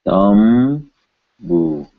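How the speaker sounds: "Thumbu" slowly, M held long before bu; lip-made M. Shown as a demonstration. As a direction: hum the m of "Thumbu" on closed lips, then release bu. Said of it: "which is with our lips".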